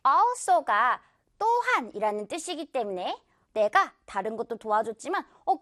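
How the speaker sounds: ordinary delivery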